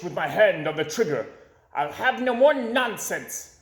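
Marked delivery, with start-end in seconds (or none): 1.7-3.6 higher, firm and stern; a boy scowling, laying down the law; stern frowning boy. A narrator's reading. Boy at the wall warns Van Itch, sternly. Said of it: "I said with a frown".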